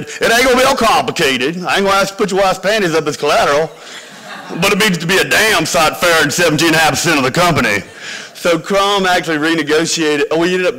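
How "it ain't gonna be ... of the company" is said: Lucius. Retold or imitated. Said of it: "My investor said".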